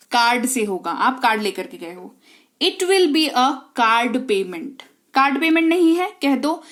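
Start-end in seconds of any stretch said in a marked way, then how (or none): 2.6-4.8 very slow, spelled-out English for practice; slow model sentence. Shown as a demonstration. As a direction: lay out every word slowly. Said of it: "teacher models the English line". (धीमी गति में अभ्यास वाक्य)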